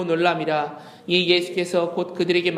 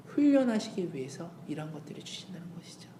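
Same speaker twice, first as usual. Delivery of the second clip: soft whisper; 3 semitones lower, 8 dB quieter than usual. Acted. Preacher drops to whisper.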